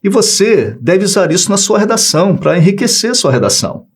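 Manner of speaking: ordinary delivery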